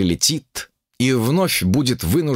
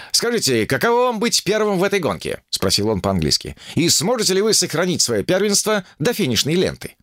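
higher, eager reporter voice; chirpy reporter, higher pitch; 9 semitones higher than usual. A narrator's reading. English reporter interviews.

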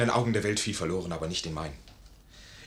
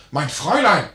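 ruft ihr laut und höher nach; lauter Ruf; 6 semitones higher, 9 dB louder than usual. Acted. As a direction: verzweifelter Ausruf hinterher. Major ruft dem Fräulein nach. (lauter Ruf)

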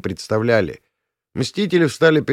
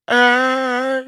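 very high drawn-out scream; high howl of pain; pained yell; 3 dB louder than usual. A narrator's reading. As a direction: cry out long and high. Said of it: "заорал царёк".

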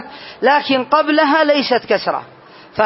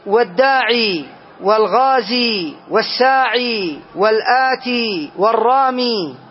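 slow listing; slower than usual. Shown as a demonstration. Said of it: "نحو".

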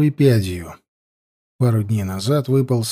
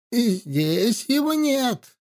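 put-on quavering old woman; 10 semitones higher, 5 dB quieter, slower than usual. Witch denies to riders.

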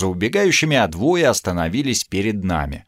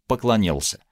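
ordinary delivery, nothing marked

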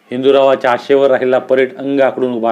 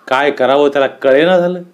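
ordinary delivery

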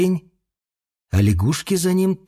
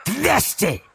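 high sharp snap like a twig; 10 semitones higher, 3 dB quieter than usual. A narrator's reading. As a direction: crack the word out sharply. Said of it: "произнёс он так резко".

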